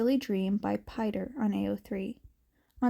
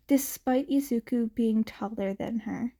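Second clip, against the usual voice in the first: ordinary delivery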